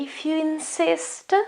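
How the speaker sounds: high, polite, elegant acceptance; dainty, courteous yielding to an offer. Shown as a demonstration. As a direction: accept politely, pitched well up. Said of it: "it's more polite if I say".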